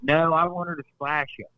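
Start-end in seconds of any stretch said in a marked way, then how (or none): none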